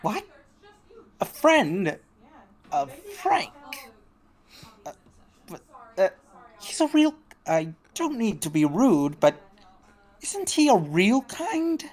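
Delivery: high, halting, incredulous voice; acted shock, tripping over the words. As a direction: stammer in disbelief, pitch leaping up. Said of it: "looks shocked as he hears this".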